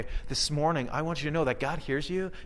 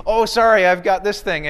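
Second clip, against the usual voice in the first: higher, louder breezy brush-off; mock excuse; 5 semitones higher, 10 dB louder than usual. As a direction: play the unreliable friend dodging again. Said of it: "you'll phone them the next week".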